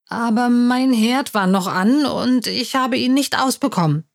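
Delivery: plain reading voice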